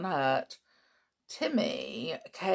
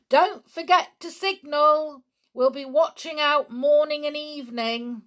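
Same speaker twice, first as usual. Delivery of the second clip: calling through cupped hands; 10 semitones higher, 9 dB louder than usual. A narrator's reading. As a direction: holler across the water. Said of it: "He cupped his hands around his mouth".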